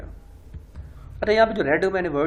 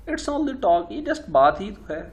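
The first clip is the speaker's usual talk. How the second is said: much higher, brushing it off lightly; dismissive wife; 6 semitones higher, 4 dB louder, slower than usual. Acted. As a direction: wave his worry away in a high voice. Wife brushes off husband's anger.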